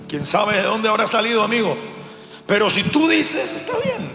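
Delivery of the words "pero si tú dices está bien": high-pitched mock concession; concesión irónica. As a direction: give in mockingly, voice jumping up. Sarcastically concedes the claim. (concesión burlona en tono agudo)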